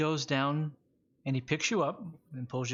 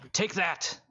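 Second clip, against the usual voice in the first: higher, punched-out fighting shout; attacking cry; 7 semitones higher, 4 dB louder than usual. Acted. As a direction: throw it out like a blow. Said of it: "he punches you in the stomach".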